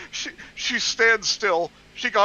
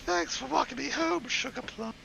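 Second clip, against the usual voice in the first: put-on soft girly voice; 5 semitones higher, 7 dB quieter than usual. Voicing sweet girlfriend.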